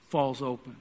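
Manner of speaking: ordinary talk, nothing marked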